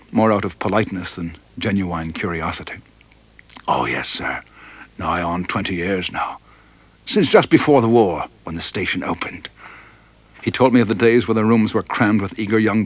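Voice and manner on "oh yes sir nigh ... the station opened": put-on low and humble, an old steward tending the fire; old mess steward. Elderly mess steward tending the fire for the pilot, lower and humble.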